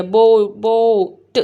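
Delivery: teacher modelling बोट slowly; slow sound-out. Shown as a demonstration. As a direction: stretch out बो- before बोट. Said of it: "जैसे कि बोट हो गया".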